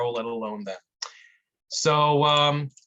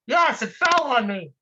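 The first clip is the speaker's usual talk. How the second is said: much higher and louder, frantic cries for help; panicked trapped man; 9 semitones higher, 4 dB louder than usual. Acted. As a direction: yell up from under the rock, desperate.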